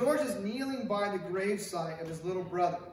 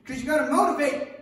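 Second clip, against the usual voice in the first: fired-up emphasis; 8 semitones higher, 12 dB louder than usual. Real, not acted. Preacher fired up.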